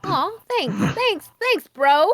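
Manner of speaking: excited. Real, not acted. Thanking the healer.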